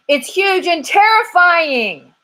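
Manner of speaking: high, loud and slow frightened squirrel; squirrel crying out. Acted. Squirrel finally finds the right words for the detective, crying out high and loud. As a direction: cry it out like a terrified squirrel. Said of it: "cried the squirrel".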